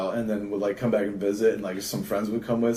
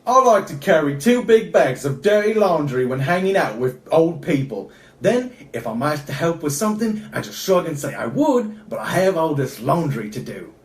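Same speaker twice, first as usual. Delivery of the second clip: lightly Australian, drifting into Cockney; amateur Australian voice sliding English; Australian accent; 4 semitones higher, 3 dB louder than usual. Shown as a demonstration. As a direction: read the card in a mock Australian accent.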